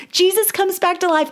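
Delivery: joyful restatement. Real, not acted. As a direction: repeat "Jesus comes back to life" briskly with a firm fall. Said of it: "I can't contain it".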